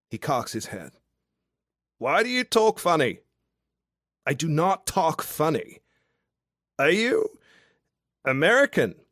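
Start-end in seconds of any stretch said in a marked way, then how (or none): 2.0-9.0 loose, cheery Australian bloke, pitched up and loud; a tipsy outback charmer calling up to a window; drunk Aussie guy. Acted. Drunk Australian guy below her window, boisterous and cheeky.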